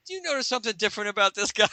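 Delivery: high, fast suspicious question; gang member. Acted. A second gang member doubting the hero. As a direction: rattle it off quickly and high. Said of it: "another one's going".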